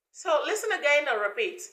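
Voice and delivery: normal talking voice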